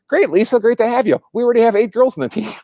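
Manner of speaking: high, cheery mock coach voice, fast at the end; Ned Flanders welcoming Lisa, high and bubbly. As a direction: chirp it like an overeager nerdy coach. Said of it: "And he goes".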